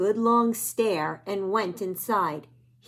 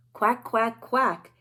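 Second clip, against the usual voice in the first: ducklings quacking; 3 dB louder, slower than usual. Acted. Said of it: "say the ducklings".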